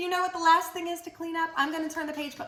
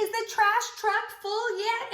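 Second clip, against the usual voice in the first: singsong question; 8 semitones higher than usual. Acted. Refrain question to children.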